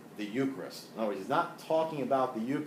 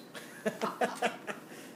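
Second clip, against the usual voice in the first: breathy laugh; 12 semitones higher, 5 dB quieter than usual. Real, not acted. Laughs after praising Rose.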